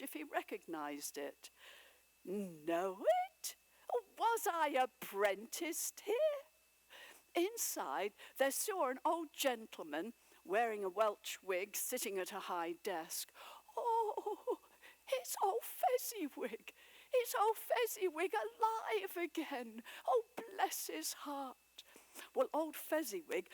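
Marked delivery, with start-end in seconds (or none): 2.2-6.4 astonished cry. A narrator's reading. Scrooge recognizes warehouse. 13.7-21.8 much higher, louder, thrilled; delighted cry. A narrator's reading. Scrooge sees old Fezziwig alive, crying out with joy.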